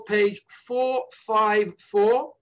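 slow and deliberate page number; slowly. Shown as a demonstration. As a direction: spell it out slowly for listeners. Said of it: "I'm gonna say this slowly".

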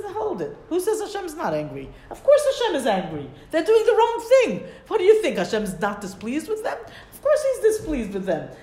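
high, loud, laughing "of course!" protest; emphatic retort. Retold or imitated. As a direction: push back with amused conviction. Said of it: "He says".

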